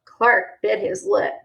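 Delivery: ordinary delivery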